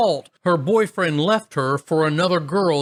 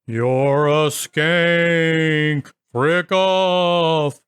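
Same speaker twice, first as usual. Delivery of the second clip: haunt her with a slow spooky moan; ghostly voice; much slower than usual. Retold or imitated. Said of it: "changed my voice to sound more ghostly like".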